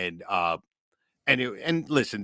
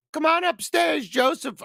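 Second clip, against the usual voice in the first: high sing-song calling voice, much higher; mother calling; 3 dB louder than usual. Retold or imitated. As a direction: call him upstairs like his mother. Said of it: "my mother ... go".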